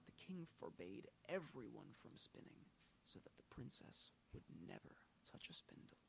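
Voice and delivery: ordinary delivery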